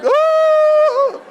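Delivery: loud, long, very high "oh"; mock howl of shock; high wailing cry. Man left shaken by parting words, howling. Shaken after leader leaves.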